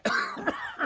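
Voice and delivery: acted cough cutting off speech; poisoned coughing. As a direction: break off into a pretend poisoned cough. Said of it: "I'm still poisoned".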